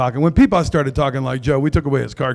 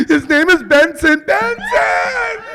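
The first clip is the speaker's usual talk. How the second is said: high, loud, panicky passenger pleading; frantic passenger; 14 semitones higher, 3 dB louder, slower than usual. Acted. Comic as frantic passenger begging the plane crew. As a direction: play a hysterical flyer who lost her pet.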